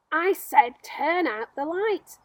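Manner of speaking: slow, loud, furious repeat; angry shout. Acted. Centipede shouting at the glowworm to turn out the light, angry and loud. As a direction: bellow it, stressing I, fed up. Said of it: "shouted the centipede angrily".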